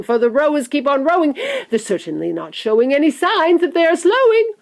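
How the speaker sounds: high, loud rhyming rant; manic crying out. A narrator's reading. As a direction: build the verse into a wild high cry. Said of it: "cried Mister Wonka".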